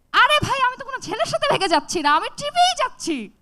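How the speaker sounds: high, loud exasperated outburst; exasperated protest. Retold or imitated. Daughter protesting loudly to her fainting mother, exasperated. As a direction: throw it back, pitched high and loud.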